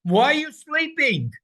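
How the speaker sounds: high, loud impassioned plea; urgent cry. Shown as a demonstration. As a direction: demand God wake up.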